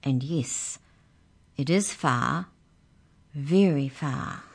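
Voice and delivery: measured pauses, slowing at the end; mysterious. A narrator's reading. Mouse telling Mallory how far the journey is, mysteriously. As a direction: draw out the ending with mystery. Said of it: "said the mouse mysteriously".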